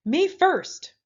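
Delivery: a pig charging over the sand shrieking. A narrator's reading. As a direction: shriek it at full gallop. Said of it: "Pinkerton shrieked".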